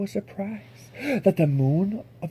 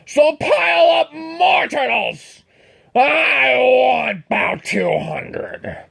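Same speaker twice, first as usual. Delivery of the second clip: thundering order; 3 semitones higher, 6 dB louder, much slower than usual. Acted. The king demands two hundred turtles, slow shout. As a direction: bellow for more turtles. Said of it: "his royal voice thundered".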